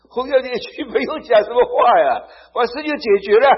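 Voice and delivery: fervent preaching. Real, not acted. Preacher to congregation, exultant and loud. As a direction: proclaim it with full fire.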